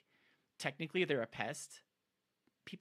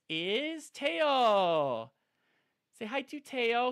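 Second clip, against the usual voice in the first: pet talk; 9 semitones higher, 7 dB louder, slower than usual. Real, not acted. Owner greeting her bearded dragon in high pet voice.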